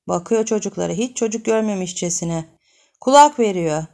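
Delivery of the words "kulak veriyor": plain reading voice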